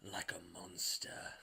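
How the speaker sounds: low, slow unvoiced whisper. Russian guy answering how he feels, slow low whisper. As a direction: breathe it out, haunted. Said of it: "And he's like".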